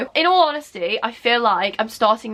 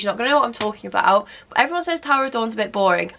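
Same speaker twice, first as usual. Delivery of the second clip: normal talking voice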